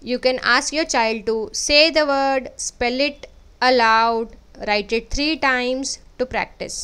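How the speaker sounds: slow, deliberate sign-off to parents; closing a parenting video, slow and clear; slow outro. Acted. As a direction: spell it out slowly for the parents.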